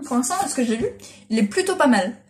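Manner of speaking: ordinary talk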